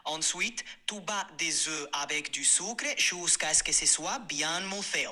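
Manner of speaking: French with a Spanish accent, chocolate said the Spanish way. Shown as a demonstration. AI reads the cake recipe in French with a Spanish accent. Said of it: "en français, mais avec l'accent espagnol".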